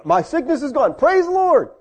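high-pitched joyful testimony; mock jubilation. Preacher mimics believer healed of sickness, jubilant. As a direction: rise into an exultant praise. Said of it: "when good things happen to us".

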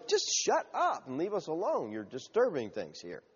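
hushed, mock irritated grumble; annoyed crowd. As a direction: shush a nuisance during study. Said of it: "The crowd was annoyed".

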